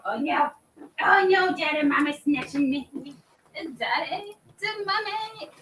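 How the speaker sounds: high squeaky voice for the puppy; puppy's voice. Pretend. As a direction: speak for the dog being carried off, high and pleading. Voicing the dog being snatched.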